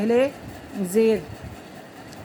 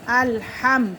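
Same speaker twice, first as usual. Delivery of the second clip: ordinary talk, nothing marked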